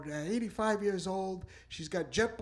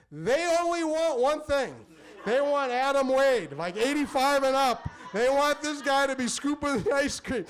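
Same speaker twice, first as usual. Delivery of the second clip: triumphant boast; 5 semitones higher, 4 dB louder than usual. Real, not acted. Comic boasting about his elderly fans, triumphant. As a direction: announce it like a champion.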